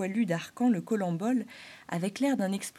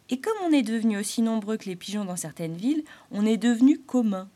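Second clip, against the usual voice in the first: grey squirrel grumbling; 3 semitones higher, 6 dB louder than usual. Acted. Grey squirrel grumbling to the springtail. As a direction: complain about red squirrels.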